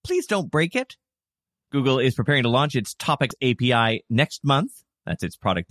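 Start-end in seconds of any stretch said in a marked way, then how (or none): 0.0-1.0 high, sweet begging tone; nicely pleading. Acted. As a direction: ask politely, almost pleading. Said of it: "asking websites nicely".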